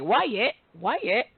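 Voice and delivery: lightly voiced little boy saying why yet for lion; a hint of a toddler babbling why yet; mild child voice repeating why yet; toddler's mispronounced lion. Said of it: "that's how he say "lion".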